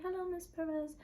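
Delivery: lightly voiced nurse waking a patient; soft nurse wake-up. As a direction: coax a sleeping patient awake. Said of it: "that's how they talk to you when you're sleeping".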